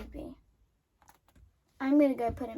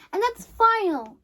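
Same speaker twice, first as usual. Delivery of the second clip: louder, higher and slow, digging in; stubborn refusal; 7 semitones higher, 6 dB louder than usual. Acted. Terry refusing to go back, stubborn and slow. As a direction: put your foot down.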